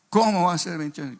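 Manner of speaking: loud, high astonished question; retold amazed outburst; incredulous. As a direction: react in lively disbelief. Disbelief at the driver's years.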